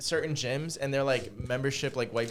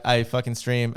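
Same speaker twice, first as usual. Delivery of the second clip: ordinary delivery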